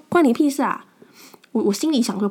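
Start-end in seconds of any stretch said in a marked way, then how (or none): 0.1-0.9 loud, sharp angry comeback; angry retort. Retold or imitated. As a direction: snap back at the nosy old man. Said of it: "我就很生气说". (生气地大声顶回去)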